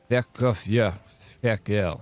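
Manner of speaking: put-on putting on a rough French accent; a loosely attempted French accent. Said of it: "do that in a French accent".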